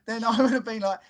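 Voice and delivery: usual speaking voice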